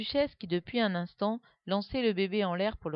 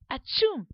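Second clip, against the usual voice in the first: sneeze; 7 semitones higher, 7 dB louder than usual. Acted. Guillaume can only sneeze.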